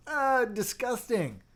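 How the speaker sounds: high, slow, drawn-out "disgusting"; mock disgust. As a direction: act out recoiling from a switch on the first press. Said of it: "you press it and you go".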